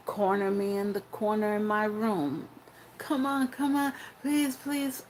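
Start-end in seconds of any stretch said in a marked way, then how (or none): 3.0-5.0 put-on begging boyfriend.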